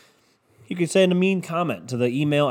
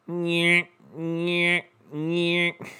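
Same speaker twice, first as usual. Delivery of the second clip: high nasal-free nyeh nyeh nyeh, imitating a dad sawing; three childish mock grunts, pitched up; mock grumble noise; 5 semitones higher, much slower than usual. Dad hacking at the tree, a high mock nyeh sound. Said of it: "He just said".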